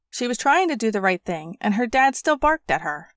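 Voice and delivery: ordinary delivery, nothing marked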